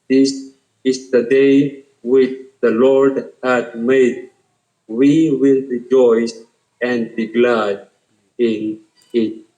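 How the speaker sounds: slow, measured Bible reading; scripture reading. Acted. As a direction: read the verse deliberately, letting each phrase land. Reads a psalm aloud.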